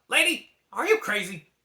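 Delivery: mock the girl's crazy idea; dragon voice. A narrator's reading. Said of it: "Mushu asked".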